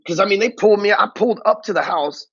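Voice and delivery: ordinary talk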